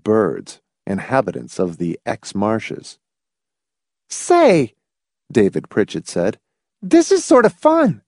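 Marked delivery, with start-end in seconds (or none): 4.1-8.0 put-on high-pitched young boy; boy's voice. A narrator's reading. Boy chiming in with Hamilton, high boyish voice. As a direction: pipe up like a boy.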